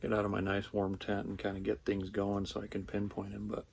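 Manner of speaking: low hushed morning talk; quiet voice. Real, not acted. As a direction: keep it hushed so the elk won't hear. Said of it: "trying to be quiet".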